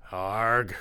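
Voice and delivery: short cry as if struck; pained vampire cry. Acted. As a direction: yelp like a blow landed. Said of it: "cried Dracula's voice".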